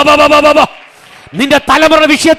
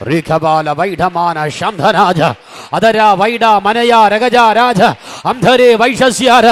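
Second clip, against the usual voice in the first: deep prayer in tongues; 6 semitones lower, much slower than usual. Real, not acted. Preacher sinking into prayer before the congregation, low slow tongues. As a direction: drop the voice low and let the syllables drift slowly.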